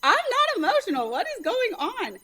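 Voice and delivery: bewildered through tears. Retold or imitated. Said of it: "and I was like".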